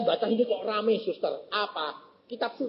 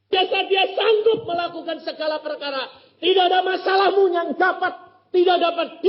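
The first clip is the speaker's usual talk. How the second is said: much higher, louder sermon cadence; full-force preaching; 13 semitones higher, 7 dB louder than usual. Acted. Preacher urging the congregation, loud full-force preaching.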